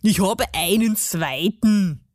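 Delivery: put-on dialect dwarf voice; self-satisfied little dwarf; boastful garden gnome. A narrator's reading. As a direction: announce it proudly as a cranky gnome. Gnome boasts of other arm.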